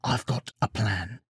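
soft, secretive huddle voice; whispered. A narrator's reading. Odysseus shares a plan with his men, whispered. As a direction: confide it under your breath. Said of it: "whispered Odysseus".

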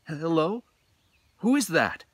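a blind musician startled in the dark; nervous Hoichi. A narrator's reading. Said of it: "Hoichi asked the knight".

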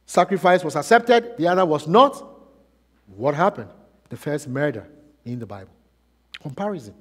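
normal talking voice

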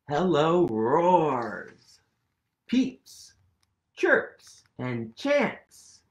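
ordinary talk

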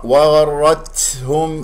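وغرتهم slow with exaggerated ت; wrong overdone. Shown as a demonstration. Teacher showing students an exaggerated error. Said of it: "هذا خطأ".

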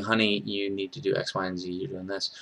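soft, low, tender reminder; gentle correction. Retold or imitated. Girlfriend as she should have told him, gentle correction. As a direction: imitate a partner correcting someone gently. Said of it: "You do it gently".

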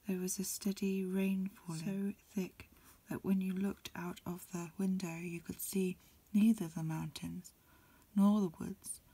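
ordinary delivery, nothing marked

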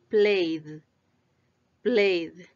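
played in one syllable ending on a buzzing D; voiced D. Shown as a demonstration. Teacher models past-tense verbs, voiced D on played. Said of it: "Ahora vamos con la D".

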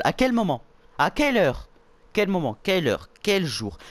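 loud, high rapid-repeat questions; incredulous demand. Real, not acted. Player disputes that Monica is crazy, incredulous and loud. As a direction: fire off each question in loud disbelief.

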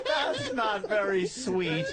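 laughing shout. Real, not acted.

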